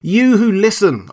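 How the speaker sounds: high, ringing appeal; crying out. A narrator's reading. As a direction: call out to a crowd of listeners. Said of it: "I cried".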